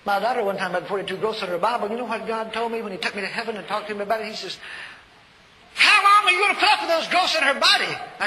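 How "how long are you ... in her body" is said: high, loud booming voice of God; God demanding an answer. Retold or imitated. God booming at the preacher in his vision.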